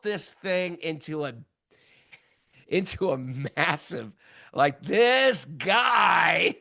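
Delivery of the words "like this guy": mock outraged shout. Fuming about the arrogant man.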